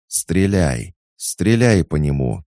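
loud, high command; shouted order. A narrator's reading. Catherine orders the sentries to shoot, shouting. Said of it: "крикнула Екатерина".

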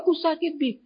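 put-on high, soft child voice; hurt child. Child telling father it hurts, small high voice. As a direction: play a small child complaining of pain.

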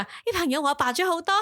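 high-pitched mimicry of an ad testimonial; put-on high commercial voice; Sammy. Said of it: "Sammy嗰個咪就係".

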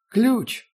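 high squeaky crone; old woman voice. Acted. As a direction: blurt it out as an old hag. Barge woman answers.

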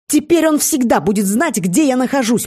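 high, heated outrage; growled complaint. A narrator's reading. Seer furious at being tracked, heated growl. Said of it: "прорычала она".

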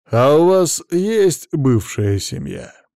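slow, drawn-out condescension; patronizing, leisurely question; condescending. A narrator's reading. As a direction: ask it slowly, talking down to them. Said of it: "поинтересовался Влад снисходительно".

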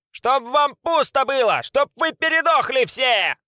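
high, slow shrieked curse; screaming curse. A narrator's reading. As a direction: scream curses after them. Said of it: "кричала им вслед отборным матом".

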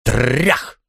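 rolled-r crash sound; crashing blow. A narrator's reading. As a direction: voice the bang of a chair on a door. Narrator imitates blows on door.

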